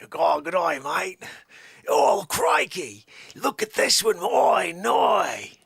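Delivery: put-on loud Australian accent with crikey excitement; hammed-up Australian accent, loud and excited; Australian croc hunter. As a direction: play a thrilled Aussie croc hunter. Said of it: "do a Croc-a-Mark Dundee".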